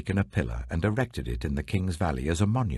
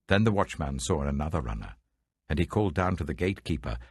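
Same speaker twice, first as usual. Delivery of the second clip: ordinary delivery, nothing marked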